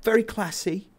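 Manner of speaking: high-pitched Scouse voice; Scouse accent. Shown as a demonstration. Showing off the key phrase, high-pitched Scouse accent. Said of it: "the, the Scouse accent".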